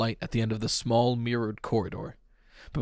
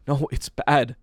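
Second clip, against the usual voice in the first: ordinary delivery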